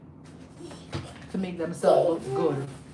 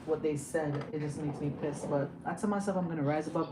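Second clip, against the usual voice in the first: quiet simmering anger; 3 semitones lower, 7 dB quieter than usual. Real, not acted. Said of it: "I'm just being triggered".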